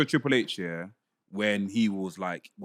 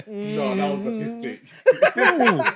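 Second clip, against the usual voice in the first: laughing; 7 semitones higher, 4 dB louder, much slower than usual. Real, not acted. Laughing at wrestling booking.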